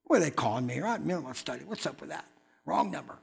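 low, quiet grumble to himself; mildly annoyed. Retold or imitated. Preacher interrupted in the middle of his study, mildly annoyed. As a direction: mutter it like someone interrupted mid-task. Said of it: "At first I was kinda annoyed".

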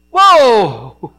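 loud slow exclaim; excited stop. Retold or imitated. Pastor telling tow driver to stop, loud and excited. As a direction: halt everything, loud and slow.

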